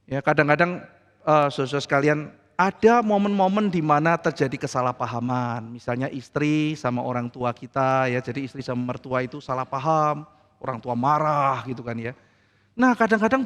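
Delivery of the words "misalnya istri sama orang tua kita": normal talking voice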